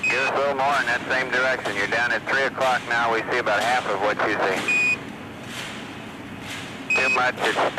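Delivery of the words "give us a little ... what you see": normal talking voice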